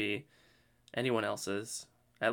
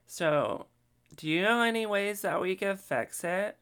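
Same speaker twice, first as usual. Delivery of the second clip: higher-pitched imitated Kardashian accent; mock Kardashian accent, high and unhurried; 5 semitones higher than usual. Shown as a demonstration. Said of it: "could do a Kardashian accent".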